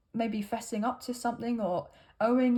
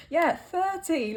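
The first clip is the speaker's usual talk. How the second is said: put-on slow, higher, laughing Irish accent; American Irish accent; 5 semitones higher, slower than usual. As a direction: stretch out "thirty" with a laugh in the lilt. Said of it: "weird American Irish accent".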